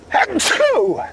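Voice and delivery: loud sudden sneeze; a big real sneeze; sneezing. Real, not acted. Another sneeze.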